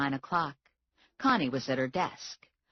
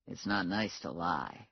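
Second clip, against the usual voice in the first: Morelli, low; 9 semitones lower, 6 dB quieter than usual. A narrator's reading. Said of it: "Morelli said".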